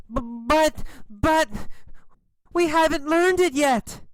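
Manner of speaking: put-on high-pitched frightened boy; high, stuttering, fearful protest; scared stammer. A narrator's reading. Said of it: "are very scared".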